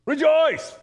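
high, loud, punchy cry; emphatic exclamation. Retold or imitated. Paul insisting on joy to his readers, loud and high. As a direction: proclaim it with an exclamation point. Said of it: "He's, like, being emphatic".

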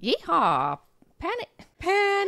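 yeehaw, then Penny hollered high in a mock Southern accent. Shown as a demonstration. Answering her cohost, hollers Penny in a Southern accent. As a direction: call Penny like a cowgirl. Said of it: "How would they say penny in a Southern accent".

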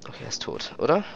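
whispered stealth check; hushed, careful whisper. Real, not acted. Stealth kill and grab, hushed.

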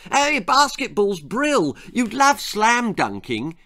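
loud, high excited shout; shouting boy. A narrator's reading. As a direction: shout it out eagerly. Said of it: "he shouted at the top of his voice".

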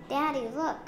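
put-on high child's call for attention; eager storybook exclamation; excited cry. A narrator's reading. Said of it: "cried Lola".

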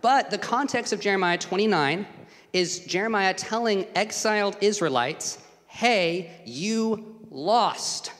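high, loud, driving sermon voice; full-force preaching. Acted. Preacher drives home the exiles' message to his congregation, loud and high. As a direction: preach it hard to the room.

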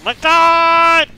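high, loud, held cry; exasperated shout. Real, not acted. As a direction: yell it out, stretching the last word. Another failure in game.

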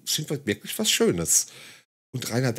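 ordinary talk

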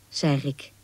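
ordinary delivery

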